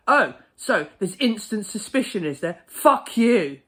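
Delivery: loud, high, hostile snapping back; aggressive retort. Retold or imitated. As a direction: spit it out like a rude customer insulting staff. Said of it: "asked why in a very aggressive way".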